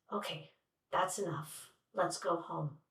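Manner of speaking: put-on calm older brother. Brother ending the street fight, low and quiet. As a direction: quietly call it over and head home.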